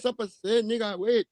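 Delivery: lightly imitated Haitian Creole accent; mock Haitian accent. Imitating the Haitian co-host scheming against the guest, in a mock Haitian accent. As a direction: put on a Haitian accent, half joking. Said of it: "The Haitian be like".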